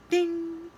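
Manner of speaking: high, sustained bell sound; elevator ding. A narrator's reading.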